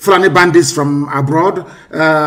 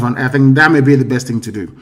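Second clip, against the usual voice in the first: normal talking voice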